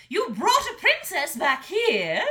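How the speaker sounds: loud, high scolding dragon mother; angry mother dragon. A narrator's reading. Mother dragon scolding her son, loud and high. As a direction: tear into her son for his foolishness.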